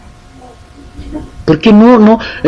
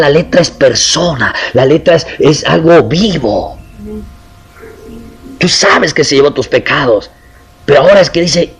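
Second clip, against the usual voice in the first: usual speaking voice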